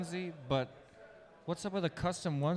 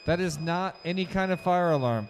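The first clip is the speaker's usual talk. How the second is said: louder, slowed mock public announcement; mock announcer; 3 semitones higher, 7 dB louder than usual. Co-host to viewers after a lipo alarm, hammed-up announcer calm. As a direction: play the calm stadium announcer, tongue in cheek.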